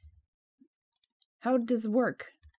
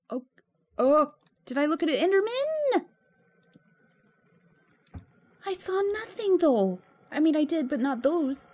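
high, loud panic at an Enderman; panicked; 8 semitones higher, 7 dB louder than usual. Real, not acted. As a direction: blurt it out in a fright.

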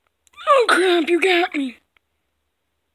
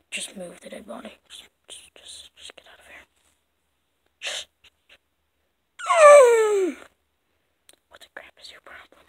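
whispering; 9 semitones higher, 3 dB louder, faster than usual. Acted. Whispered asides over the body.

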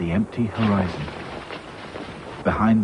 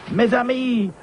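put-on high, French-accented cry; 13 semitones higher than usual. A narrator's reading. Claude cries out to his friends in French accent. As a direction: call out like a Frenchman. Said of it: "cried Claude".

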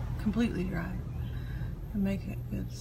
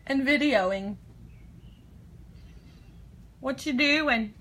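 loud, high call across room; 4 semitones higher, 6 dB louder than usual. Real, not acted. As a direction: call out cheerfully. Calling out to husband.

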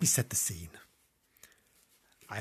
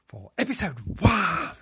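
slow, louder title; grand sign-off; 4 semitones higher, 6 dB louder, slower than usual. Acted. Host alone, grandly closing episode one. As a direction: announce it like a jingle.

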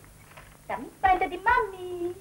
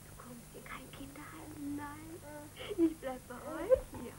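put-on soothing a child; 7 dB quieter than usual. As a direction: hush the baby gently. Mother comforts crying child. (sanftes, leises Trösten eines Kindes)

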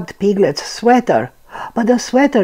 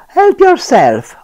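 cheerful; 7 semitones higher, 7 dB louder than usual. A narrator's reading. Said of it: "Owl said".

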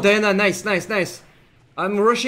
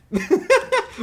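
high burst of giggling; laugh. Real, not acted. Laughing at being offered the item.

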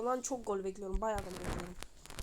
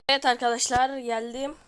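"Evet arkadaşlar" belted out much louder, punchy "Evet"; energetic intro; 2 semitones higher, 13 dB louder than usual. Shown as a demonstration. Gamer redoing his greeting to viewers, loud and hyped. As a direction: open like a hyped YouTuber greeting. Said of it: "bir dakika".

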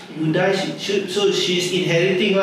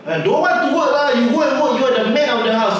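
lightly voiced wife, higher and quick; a hint of a wife brushing him off, fast and higher; mock wife; 6 semitones higher, faster than usual. Wife answers husband about working.

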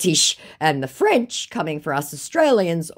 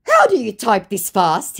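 loud, higher-pitched question; mock baffled scammer; 7 semitones higher, 7 dB louder than usual. Scammer asking about her fast typing, mock baffled. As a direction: ask in hammed-up amazement. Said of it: "he said".

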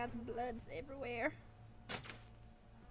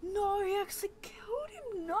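high, louder wail of dismay; crying out; 10 semitones higher, 3 dB louder than usual. Real, not acted. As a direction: cry out as if heartbroken. Friend's character killed on screen.

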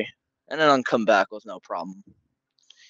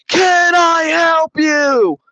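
slow, much higher shouted Can I help you with a hammed-up push on Can; mock shout; 6 dB louder, much slower than usual. Neighbor shouting at the narrator walking away, slow high mock yell. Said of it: "she says it again".

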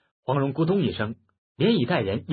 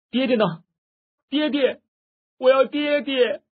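wailing cry; 12 semitones higher, 3 dB louder than usual. A narrator's reading. Girl wakes calling for her dead father, wailing cry. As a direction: wail loudly for a lost father. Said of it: "大哭叫道".

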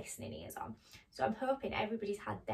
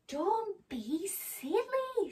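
high, slow, drawn-out chiding; mock scolding; 8 semitones higher, much slower than usual. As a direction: stretch it out to tease a silly friend. Nibbles scolds the frightened mouse.

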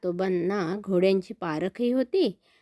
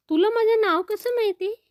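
much higher, louder amazed question; astonished child; 12 semitones higher, 4 dB louder than usual. Acted. Boy asking the talking horse how it knows his name, amazed. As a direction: gasp it out as a stunned little boy.

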